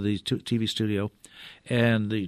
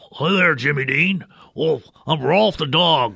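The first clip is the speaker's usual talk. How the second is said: louder, higher, slow puppet dog voice; imitating Rolf the dog, higher and louder; 5 semitones higher, 6 dB louder than usual. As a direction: play the piano dog greeting Jimmy. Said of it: "Jimmy said".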